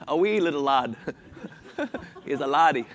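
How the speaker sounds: playful mock Scottish, rolling out the laddie; a light, laughing stab at a Scottish brogue; Scottish accent. Preacher answering the congregation's call for it in a light Scottish accent. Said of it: "say it in Scottish".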